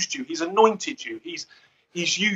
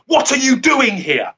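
higher, sharp telling-off to a pupil; stern teacher; 7 semitones higher than usual. Shown as a demonstration. Teacher scolding pupils who misbehave, stern snap. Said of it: "that's not the way I talk to my pupils".

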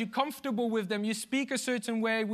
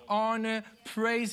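ordinary delivery

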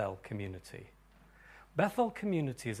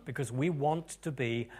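ordinary delivery

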